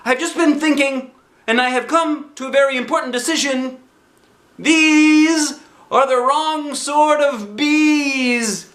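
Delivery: high, bright bear voice calling out; Pooh's cheerful cry. Acted. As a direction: call like a happy little bear. Pooh voiced, decisive.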